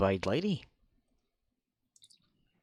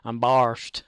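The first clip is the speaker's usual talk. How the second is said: mock southern accent; 7 dB louder than usual. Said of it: "with a southern accent".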